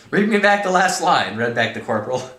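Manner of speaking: high, loud quote easing into narration; dramatic story reading. Acted. Corporal reading back to colonel, loudly animated. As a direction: act the line, then narrate it. Reads novel aloud dramatically.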